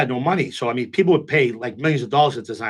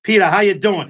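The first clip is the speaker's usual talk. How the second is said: loud happy greeting; 6 semitones higher, 8 dB louder than usual. Retold or imitated. Doorman greets him at Centerfolds.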